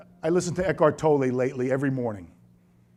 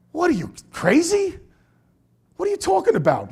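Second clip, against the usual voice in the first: high-pitched, disbelieving challenge; mock incredulity; 12 semitones higher than usual. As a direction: act out baffled disbelief, voice jumping up. Acted disbelief at the seminar teacher.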